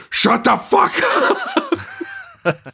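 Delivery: high, sharp mock outburst; imitated furious shut up; mock angry snap. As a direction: bark it like an irate boss. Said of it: "And he's like".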